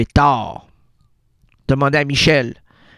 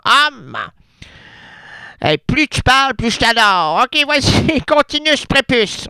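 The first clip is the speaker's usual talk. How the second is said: [name]; 7 semitones higher than usual. Acted. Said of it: "[name]".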